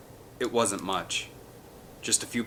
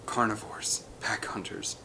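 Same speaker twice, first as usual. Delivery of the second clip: whispered, much softer; hushed whisper; 8 dB quieter than usual. A narrator's reading. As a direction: whisper it like a warning. Hushed description of raptors.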